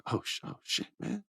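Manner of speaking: whispered, breathless panic; panicked whisper. Retold or imitated. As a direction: gasp it under your breath. Sami panicking.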